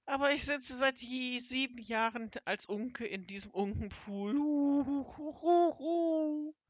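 high lament breaking into loud boo-hoos; hammed-up high howling sobs; tearful wailing. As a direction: wail like a storybook creature in misery. Toad bewails its enchantment.

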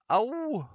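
high, loud wailing yelp; hammed-up yowl of agony; howl of pain. A narrator's reading. Seppel shams a bellyache, howling. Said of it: "heulte Seppel".

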